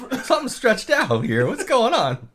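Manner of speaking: high-pitched pretend puzzlement; comic alarmed questioning; mock bewilderment. Acting husband puzzled at wife's body.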